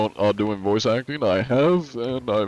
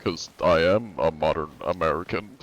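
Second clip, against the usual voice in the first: ordinary talk, nothing marked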